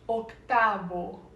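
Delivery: slow octavo with the v said as b; Spanish octavo. Shown as a demonstration. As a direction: say octavo as oc-ta-bo, slowly. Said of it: "se escreve octavo, mas a gente pronuncia octavo".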